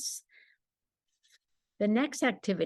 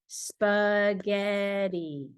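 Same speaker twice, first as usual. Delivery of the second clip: "Spaghetti" drawn out very slowly, syllable by syllable; much slower than usual. Shown as a demonstration. Parent to child, slowly breaking the word into syllables. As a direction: pull "Spaghetti" apart so the child can hop each part. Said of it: "How many syllables?".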